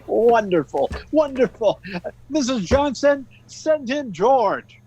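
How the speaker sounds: high, loud delighted exclaiming; jubilant. Acted. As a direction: crow with delight, then call out to the secretary. Delighted boss summons employee.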